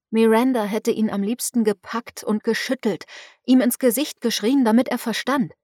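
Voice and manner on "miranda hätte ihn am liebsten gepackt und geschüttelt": ordinary delivery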